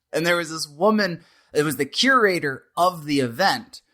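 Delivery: ordinary talk, nothing marked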